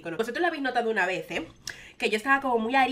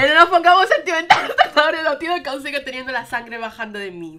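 loud, higher laughing talk; 5 semitones higher, 13 dB louder than usual. Real, not acted. Answering loving chat messages, loud laughter. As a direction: brush it off while laughing. Chat says "we love you.

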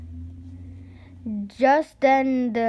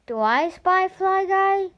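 put-on higher hopeful boy's ask; hopeful question; 9 semitones higher, 4 dB louder than usual. Boy checking a hiding fly, higher and hopeful. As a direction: ask it hoping it's him.

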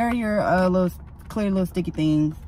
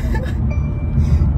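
pained laugh; 5 dB louder than usual. Real, not acted. Laughing at her own pain.

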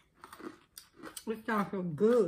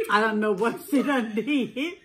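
ordinary delivery

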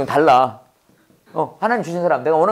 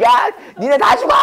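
mock yell; 6 semitones higher than usual. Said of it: "이러고 막 이러고".

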